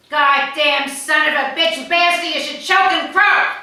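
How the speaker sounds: loud mock tirade, rising in pitch toward the end; hammed-up furious curse; retold cursing rant. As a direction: spit out the curse like an angry old man. Dad cursing at caller.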